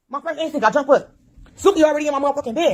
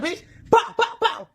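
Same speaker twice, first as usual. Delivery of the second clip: mock gunshots; 4 semitones higher, slower than usual. Imitating gunshots with laughter.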